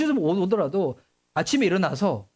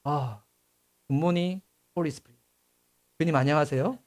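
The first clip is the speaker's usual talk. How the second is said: quiet, tender greeting to God; gentle prayer on waking; soft morning greeting; 6 semitones lower, 3 dB quieter than usual. Shown as a demonstration. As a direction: greet the Lord softly as you wake. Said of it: "눈 뜰 때 이렇게 시작해 보세요".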